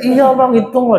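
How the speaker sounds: high, eager wife's voice; wife pushing her husband to count it; excited wife. Retold or imitated. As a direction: urge him to count the money. Wife urges him to count.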